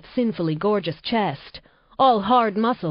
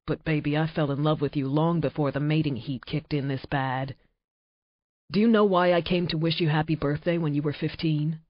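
Nate, low and intense; 3 semitones lower than usual. Acted. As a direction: speak low and close, a man confessing his love. Nate's low male voice.

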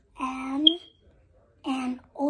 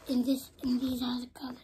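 quick whispered comment to the camera; whispered aside; much faster than usual. Real, not acted. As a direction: rush it out in a whisper. Child whispers aside after the end.

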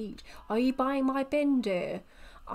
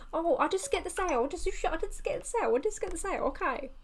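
higher, fast, flustered sales pitch; flustered seller; 6 semitones higher, much faster than usual. Acted. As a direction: gabble it out like a desperate seller. Voicing pushy seller in game.

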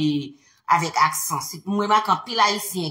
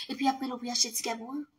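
ordinary talk

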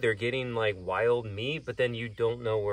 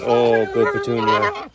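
loud, drawn-out praise; slow, doting praise for an animal; praising a pet; 11 dB louder, slower than usual. Real, not acted. Keeper rewarding Daisy the pig for sitting, drawn-out praise.

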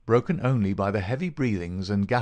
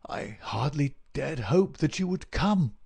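slow, higher, halting invalid; weak sick man; 6 semitones higher than usual. A narrator's reading. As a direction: speak feebly and slowly from the sickbed. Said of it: "Holmes whispered".

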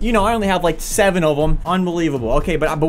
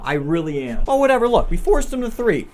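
ordinary talk, nothing marked

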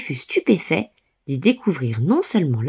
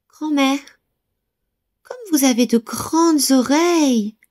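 put-on little girl voice; 8 semitones higher than usual. A narrator's reading. Girl questions grandmother.

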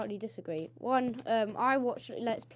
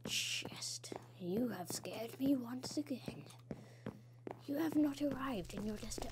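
whispered; 3 semitones higher, 6 dB quieter than usual. Acted. Gamer whispering to a friend in game. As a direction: breathe it out secretly.

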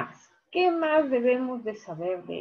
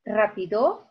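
"rápido" with final O stressed and rising; stress on last syllable. Shown as a demonstration. Teacher testing where stress falls in "rápido", last-syllable stress. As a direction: wrongly land the stress of "rápido" on DO. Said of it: "Yo digo".

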